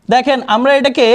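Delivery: ordinary talk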